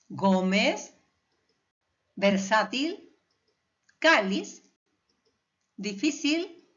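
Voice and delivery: Gómez, versátil, cáliz, difícil, each leaning on GÓ, SÁ, CÁ, FÍ; stress on penultimate syllable. Shown as a demonstration. Teacher listing grave words, Gómez, versátil, cáliz, difícil stressed on the penúltima. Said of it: "el golpe de voz está en la penúltima sílaba".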